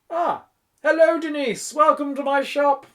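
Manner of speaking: very high, loud welcome; effusive shopkeeper. A narrator's reading. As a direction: greet the newcomer with huge delight. Shopkeeper greeting a new customer.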